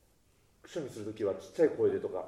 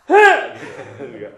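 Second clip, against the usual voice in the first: sudden loud sneeze-like burst; sneeze-like shout; 14 dB louder than usual. Shown as a demonstration. Coach shows the sneeze-shout to the singers with a loud burst. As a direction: let it explode like a sneeze. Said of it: "くしゃみみたいな感じがいいですね".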